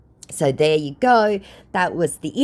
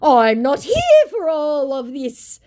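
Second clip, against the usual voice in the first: higher, slow, huffy put-on voice; mock scammer voice; 10 semitones higher, 4 dB louder, slower than usual. Vlogger voicing the bored scammer's huffy line. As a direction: play the scammer pretending not to care. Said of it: "said the scammer".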